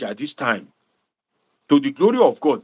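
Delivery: usual speaking voice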